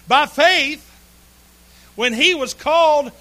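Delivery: loud, high, slow preaching of the verse; preaching scripture. Acted. Preacher proclaiming Hebrews to his congregation, loud and slow. Reads scripture at full force.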